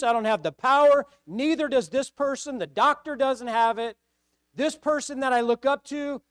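loud, high pleading cry to God; desperate prayer. Acted. Believer crying out to God in need, loud pleading. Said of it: "and you said".

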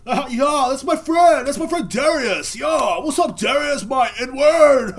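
high, loud mock bro greeting. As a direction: shout it with hammed-up, over-friendly excitement.

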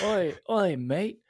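tentative stab at an Aussie accent; attempted Australian accent. Shown as a demonstration. Co-host guessing how Australians sound, in a tentative Australian accent. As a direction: try out an Australian accent, unsure. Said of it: "what does Australians sound like?".

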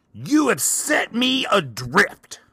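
high, slow, theatrical wail at the voice; dramatic lament. Retold or imitated. Arguing with the unseen voice in Hobby Lobby, high hammed-up lament. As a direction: stretch it out like a tragic hero. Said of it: "audibly arguing with this voice".

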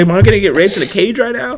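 high, laughing disbelief; half laugh it out in mock alarm; laughing panic. Picturing himself caged by the stranger, laughing mock panic.

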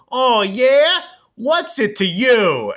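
high-pitched, cocky sitcom comeback, stretched at the end; TV guy. Retold or imitated. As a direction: ham it up like a sitcom character. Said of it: "we hear some guy on the T V go".

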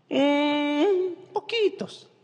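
high, coy little voice of a shy patient. Retold or imitated. Mimicking a shy patient to the doctor, high and coy. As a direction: play it sheepish and small.

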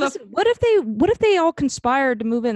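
high, fast conspiracy rant; building up a wild theory; excited. Real, not acted.

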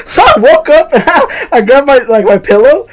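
high, loud words broken up by real laughter; laughing while talking. Real, not acted. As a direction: barely get it out through the laughs. Cracking up after own retold scream.

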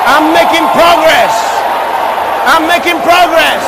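loud declaration to a congregation; rousing, shouted confession of faith; full-force preaching. Real, not acted. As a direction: proclaim it in emphatic bursts for the crowd to repeat.

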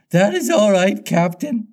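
high-pitched, complacent pirate sidekick. A narrator's reading. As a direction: answer the captain high and self-satisfied. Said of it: "Smee answered complacently".

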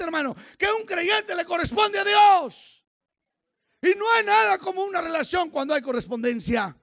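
high, loud shouted sermon; full-force preaching. Real, not acted. Preacher telling congregation of God's love, full-force preaching. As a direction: proclaim it to the whole church at a shout.

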